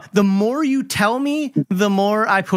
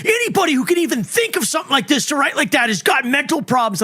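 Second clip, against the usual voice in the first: mock rant; 6 semitones higher than usual. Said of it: "he's all going off on me".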